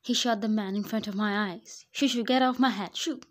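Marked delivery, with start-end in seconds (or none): 1.9-3.2 loud, higher shoo-shoo, shooing a pest away; startled shout to chase something off. Acted. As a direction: flap and yell it off your head. Shooing someone away in a daydream.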